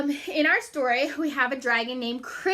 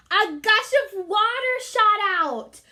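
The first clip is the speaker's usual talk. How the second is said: higher and louder, excited; big reveal; 7 semitones higher, 6 dB louder than usual. A narrator's reading. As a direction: announce the surprise.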